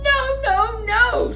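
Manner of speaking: very high squeaky no, no, no; wolves refusing. Acted. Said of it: "said the three little wolves".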